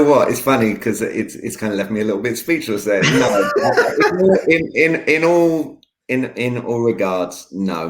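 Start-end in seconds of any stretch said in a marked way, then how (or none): 3.5-4.4 high burst of laughter; real laugh. Real, not acted. Coach laughs off host's question about abs, high burst. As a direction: break up laughing, high and loud.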